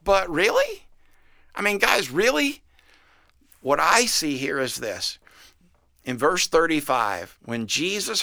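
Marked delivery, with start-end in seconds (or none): 0.0-2.6 disbelieving "really", pitched much higher; incredulous. Retold or imitated. Pastor asking the church leaders in mock disbelief, incredulous. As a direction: ask it like you can't believe what you're hearing. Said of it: "You know what I would say?".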